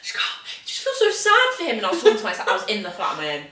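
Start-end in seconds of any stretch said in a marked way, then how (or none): 0.0-1.8 whispered start, then a high wobbling mock wail; imitating tearful self. Retelling herself crying at a sad film, mock wail. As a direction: replay your tearful self from the movie night. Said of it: "proper tearing up".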